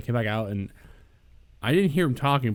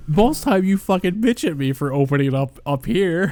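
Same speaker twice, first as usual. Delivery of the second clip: laughing, louder and higher; loud laughing complaint; 6 semitones higher, 8 dB louder than usual. Real, not acted. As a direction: laugh out a loud mock gripe at friends. Teasing friends, laughing.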